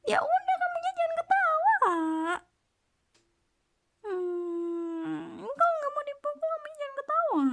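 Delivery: fake-stern whiny warning; mock threat. Mock threat to stop laughing. (ancaman pura-pura, nada jauh lebih tinggi)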